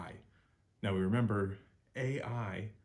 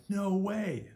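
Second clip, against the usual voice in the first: "no way" slow, high and loud in disbelief; amazed no way; 12 semitones higher, 6 dB louder than usual. Shown as a demonstration. Teacher acting out disbelief to model "way". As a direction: stretch "no way" out like you're stunned. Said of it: "pronounced like way".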